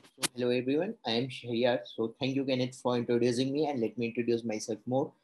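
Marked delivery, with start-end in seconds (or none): none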